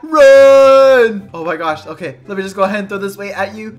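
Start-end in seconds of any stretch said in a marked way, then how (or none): 0.0-1.3 very loud, drawn-out yell; full-volume mock panic; shouted alarm. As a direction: bellow a warning to flee. Acted chase.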